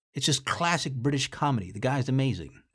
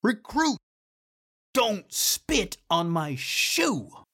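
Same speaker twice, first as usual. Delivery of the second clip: raised, slow mock commander's order; retold yell; 4 semitones higher, much slower than usual. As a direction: stretch out the reprimand, imitating the commander. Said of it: "when he yells".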